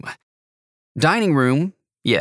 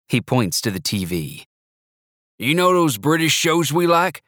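put-on proud old grandpa, a bit higher; 5 semitones higher than usual. Narrator voices Grandpa.

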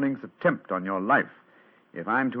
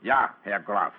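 Holmes trying out a German count's accent; German accent demo for the count's approval; 3 semitones lower, slower than usual. Shown as a demonstration. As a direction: show off the German accent on request. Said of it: "Try it once again, Herr Holmes".

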